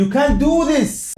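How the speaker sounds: slow, drawn-out dramatic English line; drawn-out mock plea. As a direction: stretch each word like a movie plea. Sudden English line, stretched slow.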